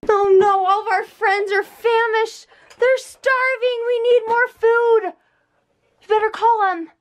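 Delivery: high, bright make-believe voice; pretend play voice. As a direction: play a rescue game with a toddler.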